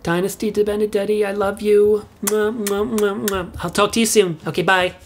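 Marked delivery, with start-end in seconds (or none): 2.2-3.5 four smacking air kisses; blown kisses. Acted. Mother ending a voicemail, blowing kisses. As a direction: blow kisses down the phone.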